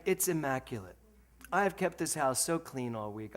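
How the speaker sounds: ordinary talk, nothing marked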